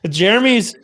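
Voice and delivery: ordinary delivery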